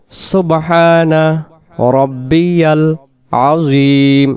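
সুবহানা রব্বিয়াল আজিম word by word, vowels held; correct recitation. Shown as a demonstration. Teacher going from the start, slow careful recitation. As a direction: draw out each word with pauses between. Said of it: "শুরু থেকে".

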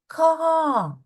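"Ка" high, the "а" held long and then falling; stretched last sound. Shown as a demonstration. Teacher asks for the last sound, "Ка" with a long "а". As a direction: hold the vowel of "Ка". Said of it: "останній звук у другому складі".

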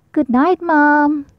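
put-on Baby Owl saying goodnight to mom, slow; sleepy goodnight. A narrator's reading. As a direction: drift off saying it. Bedtime goodnight.

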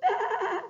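high, frightened lamb's baa; very high pleading baa; crying bleat. A narrator's reading. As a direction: cry out like a scared lamb. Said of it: "cried the little lamb".

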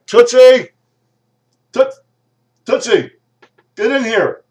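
high, loud yell across the office; calling out. Acted. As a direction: holler for the secretary to come in. Calling secretary from another room.

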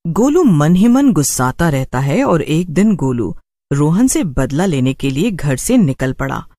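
plain reading voice

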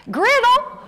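high, loud Southern pronunciation; Southern child's ringing call; Southern accent. Retold or imitated. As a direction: call the name with a Southern short i. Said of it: "because I have a Southern accent".

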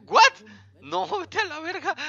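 high, loud shocked squawk; outraged yelp. Real, not acted. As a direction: blurt it out in disbelief. Outraged at marriage request.

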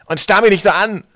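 loud, high indignant scolding; cross interruption. A narrator's reading. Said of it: "unterbrach der andere ihn".

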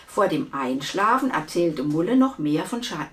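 ordinary delivery

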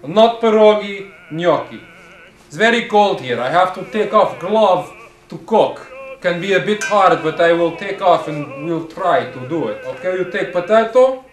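thick put-on Russian accent, deliberate delivery; comic Russian-accented chef explaining a recipe. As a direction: present a cooking show as a Russian host.

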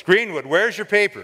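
sharp question to a student; mild stern demand. Retold or imitated. Professor calling out a student, a hint of a stern demand.

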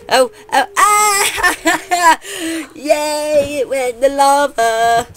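high, loud excited cheer; excited squeal. Real, not acted. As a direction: squeal with delight. Arrow hits lava.

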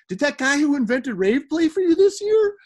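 high, loud put-on mom voice; mom's voice. Son imitates his mom asking about the rave guy. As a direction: ask it like a sweet, doting mother. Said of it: "I'm using that voice".

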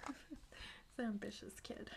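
soft whispered aside; whispering. Real, not acted. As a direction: breathe it as a secret aside.

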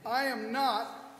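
ordinary talk, nothing marked

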